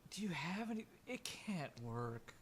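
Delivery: hushed, halting disbelief; acted disgust. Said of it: "he just has the look of just pure disgust".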